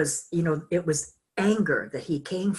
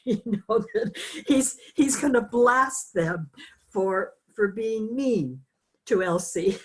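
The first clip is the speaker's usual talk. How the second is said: laughing; 5 semitones higher than usual. Real, not acted. Laughs at own imagined scene.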